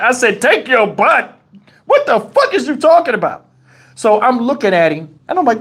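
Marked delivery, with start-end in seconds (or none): 0.4-3.5 baffled. Retold or imitated. Baffled reply to cellmate.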